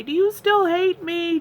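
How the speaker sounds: high, slow, mock-pitiful question; teasing husband's text. Husband teasing her by text, high and mock-pitiful. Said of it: "he'll send me a message and say".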